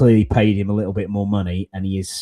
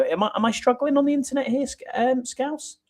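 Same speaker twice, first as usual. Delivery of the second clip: much higher, put-on squeaky voice; mock squeaky voice. Host teasing co-host about the lagging internet, mock squeaky voice. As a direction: squeak it out as a joke.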